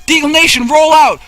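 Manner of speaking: high rallying shout; rally the troops loudly; battle cry. Pretend.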